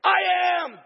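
booming high shout of God from the bush; loud divine voice calling out. Retold or imitated. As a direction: thunder it out from the burning bush. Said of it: "the voice speaks out of the bush".

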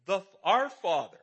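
"our" drawn out and punched loud and slow; stress on our. Shown as a demonstration. Preacher stressing to congregation that God is our own Father, loud. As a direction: lean hard on "our" in "The, our Father", slowly.

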